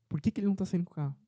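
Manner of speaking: a mother anxiously wondering aloud; puzzled mother. Retold or imitated. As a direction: rush the worried wondering. Mother puzzled watching grandfather's car.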